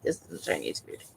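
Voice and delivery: low, soft, breathy imitation of the cooks; mock cook. Vlogger imitates the cooks judging Americanized food, low and soft. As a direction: grumble it low and soft like a disapproving chef. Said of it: "they're like".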